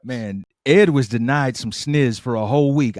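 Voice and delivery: usual speaking voice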